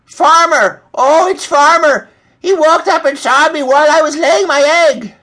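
high, loud squawking complaint; shrill-pitched flustered hen; distraught hen. A narrator's reading. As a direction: wail the news in a high hen voice. Distraught hen reports being seen.

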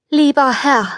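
put-on humble old beggar pleading gently; old beggar woman. Old beggar woman begs the prince, humbly. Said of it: "sagte sie".

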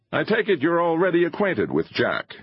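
high-pitched, energetic filmmaker; a touch of eager impatience; lightly voiced impatient showman. A narrator's reading.

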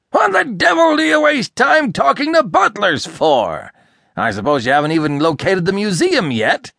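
high, loud, indignant questions; exasperated scolding. A narrator's reading. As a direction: berate him for wasting time. Irascible boss scolds his helper.

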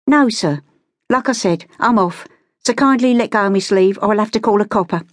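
put-on high-pitched London girl, firm; cheeky, high-pitched brush-off; Cockney street girl, defiant. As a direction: warn him off in a high street voice. Street girl threatens to leave.

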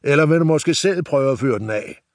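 butcher shouting at Nis, sarcastic. Acted. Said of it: "fortsatte slagteren sit råberi".

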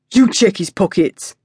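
put-on retorting kid. A narrator's reading. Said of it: "another voice retorted".

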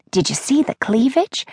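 breathy conspiratorial whisper; gossipy whisper. A narrator's reading. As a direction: lean in and whisper the scandal. Grandmother gossiping about statue.

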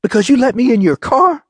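high, outraged question; heated, high-pitched retort; indignant yell. A narrator's reading. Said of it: "Diamond yelled".

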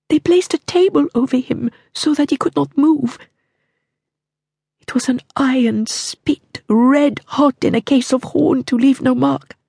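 high, hushed horror, ending in a whisper; queen describing the king's death to Mortimer; haunted queen. A narrator's reading. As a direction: tell the horror as if it might be overheard. Queen recounts the murder.

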